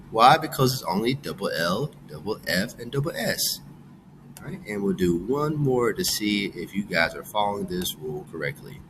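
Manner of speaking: normal talking voice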